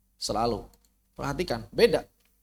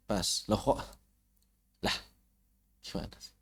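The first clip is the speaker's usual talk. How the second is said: muttered aside; 5 semitones lower, 6 dB quieter than usual. Real, not acted. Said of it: "muttering at the slide software".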